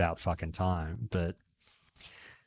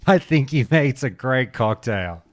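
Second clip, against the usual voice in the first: tipsy patter; 6 semitones higher, 6 dB louder than usual. Acted. Drunk pub talk acted out.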